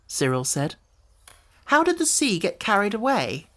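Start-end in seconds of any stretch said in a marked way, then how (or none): 1.7-3.5 put-on higher, puzzled boy; a small boy wondering aloud; high curious child voice; young boy's question. A narrator's reading. Robert asks.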